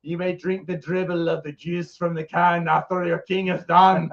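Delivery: pompous Scottish king; mock-regal Scottish decree; Scottish accent. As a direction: grant it grandly in a Scottish accent. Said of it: "I got Scottish all of a sudden".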